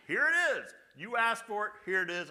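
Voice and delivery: high, loud emphatic declaration; booming mock divine answer; God's voice. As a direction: proclaim it like God handing over the sign. God voiced giving a sign.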